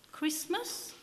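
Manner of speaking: put-on high, eager child's question; a small Yorkshire boy's bright guess. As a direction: pipe up like a small keen boy. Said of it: "volunteered a small, wiry boy".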